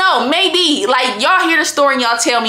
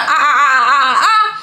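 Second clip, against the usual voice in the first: mock wailing; 3 semitones higher than usual. Mocking cry sound.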